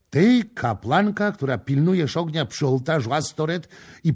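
loud, high indignant tirade; outraged outburst. A narrator's reading. Prince raging at the priestess about her marriage. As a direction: pour out his outrage at the lie. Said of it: "zawołał".

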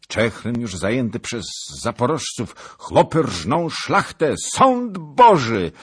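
loud, high, building to a cry; alarmed report. A narrator's reading. Rider tells of the town's fall, building alarm. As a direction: shout terrible news, stretching the last words.

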